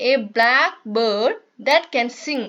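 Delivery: slow, careful textbook reading; reading aloud. Shown as a demonstration. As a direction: read each word out slowly for the class. Teacher reads textbook English slowly.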